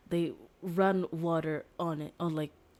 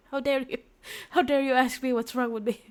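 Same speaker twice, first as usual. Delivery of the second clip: higher, louder furious retort; indignant outburst; 7 semitones higher, 7 dB louder than usual. Acted. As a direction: fire it back, outraged. Girl scolds the defender.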